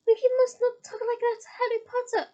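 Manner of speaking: high, loud indignant elf; a loyal elf defending his hero; high, loud, angry squeak. A narrator's reading. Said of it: "Dobby said angrily".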